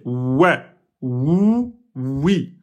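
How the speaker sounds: "ouais, oui, wou" with lips rounded and pushed forward; démonstration du waw; waw demo. Shown as a demonstration. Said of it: "on sort les lèvres, on arrondit".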